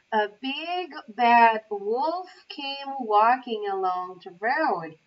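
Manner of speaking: usual speaking voice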